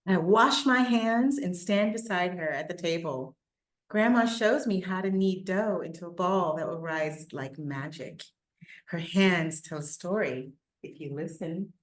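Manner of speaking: put-on steady read-aloud for children; story reading. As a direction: tell the story to listening kids. Reads picture book aloud.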